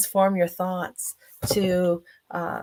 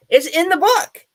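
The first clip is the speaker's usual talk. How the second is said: loud, high, amused punchline; laugh, then crow it out; laughing tease; 10 semitones higher, 9 dB louder than usual. Real, not acted. Author teases listeners about the rest of the story, laughing tease. Teasing the audience about her book.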